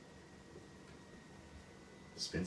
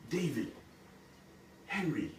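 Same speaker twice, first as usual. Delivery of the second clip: toying; 7 semitones higher, slower than usual. Acted. Queen toys with the goblin over his name, teasing. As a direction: toss out each name playfully, pitched up. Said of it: "wants to toy around with him".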